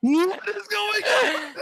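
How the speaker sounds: high, slow, laugh-filled shout; laughing disbelief. Real, not acted. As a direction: laugh out the question. Laughing at a strange DM.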